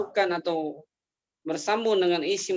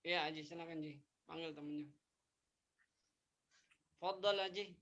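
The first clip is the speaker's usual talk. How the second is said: phone held away; 2 semitones lower, 20 dB quieter, faster than usual. Real, not acted. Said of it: "Suaranya kecil sekali ya".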